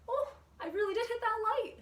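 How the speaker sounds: high, loud startled laugh-line; surprised exclamation. Real, not acted. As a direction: yelp in surprise at hitting the light. Surprised hitting the light.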